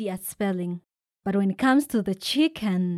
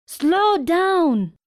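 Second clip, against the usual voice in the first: higher, louder warning; shout; 8 semitones higher, 6 dB louder than usual. A narrator's reading. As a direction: warn her to slow down. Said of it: "shouts the uncles".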